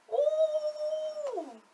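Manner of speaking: long, high-pitched "ooh"; high sustained ooh, falling at the end; impressed ooh. Real, not acted. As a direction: let out a high, drawn-out ooh of awe. Awed reaction to a deep apology.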